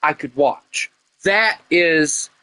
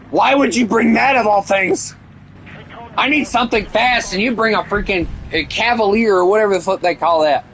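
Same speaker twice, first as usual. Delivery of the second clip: higher, exasperated complaint at a teammate; frustrated yelling; 6 semitones higher than usual. Real, not acted. Gamer yelling at teammate Chris.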